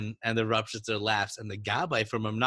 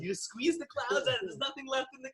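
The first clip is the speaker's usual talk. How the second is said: laughing; 12 semitones higher than usual. Real, not acted. Laughing riff on the story.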